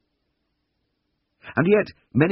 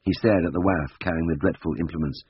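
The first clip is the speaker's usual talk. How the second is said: plain reading voice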